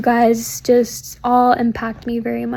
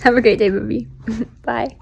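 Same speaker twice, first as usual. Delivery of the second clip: usual speaking voice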